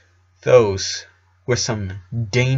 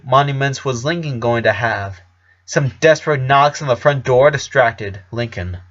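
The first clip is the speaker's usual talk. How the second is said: ordinary delivery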